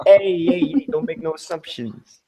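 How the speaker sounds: a light mock New Jersey tough guy; New Jersey accent. Joking back at a friend in a mild New Jersey accent. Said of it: "the real New Jersey accent".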